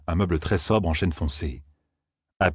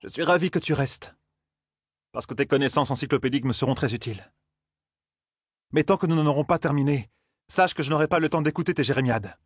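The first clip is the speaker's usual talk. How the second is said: higher, controlled cool reproof; icy reproof; 7 semitones higher than usual. A narrator's reading. Aes Sedai tells Loyal to stop whining, icy. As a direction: coolly put the complainer in their place. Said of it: "fit Moiraine d'une voix glaciale".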